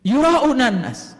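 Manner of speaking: slow chanted Arabic phrase; Quran recitation. Acted. Preacher recites the verse phrase again, slowly chanted. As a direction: intone it like tilawah.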